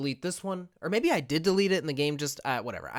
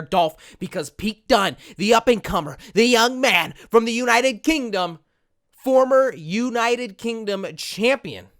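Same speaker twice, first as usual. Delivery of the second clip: louder wrestling promo, slowing on key phrases; hype promo; 4 dB louder than usual. Acted. Selling Pete Dunne versus Dolph Ziggler, hyped announcer. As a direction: build the match up like a TV announcer. Promo for the mid-card match.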